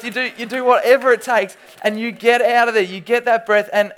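loud, fast, building panic of being held underwater; excited storytelling. Acted. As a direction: paint the headlock scene with rising alarm. Acts out pool wrestling panic.

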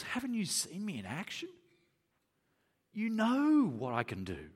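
mildly exasperated reproach; a touch of grumpy disbelief; grumpy, acted. Jesus to his disciples who doubt him, mildly grumpy. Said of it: "grumpy with his disciples".

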